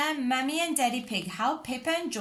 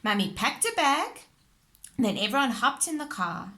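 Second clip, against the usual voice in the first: usual speaking voice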